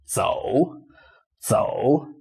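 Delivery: 走 slowly dipped, then rising; 走 in a careful Mandarin tone three; third tone. Shown as a demonstration. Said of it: "So third tone".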